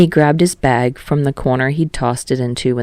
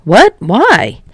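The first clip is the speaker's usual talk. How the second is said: high, loud surprised cry; startled yelp; 5 semitones higher, 7 dB louder than usual. Acted. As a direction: jump at the order. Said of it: "Kirishima startled outburst".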